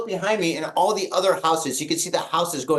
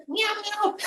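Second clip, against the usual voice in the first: tiny, very high mewing; cat meow; 5 dB quieter than usual. Retold or imitated. Josh the cat answering the worried owner, squeaky meow. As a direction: squeak out a soft imitation cat reply.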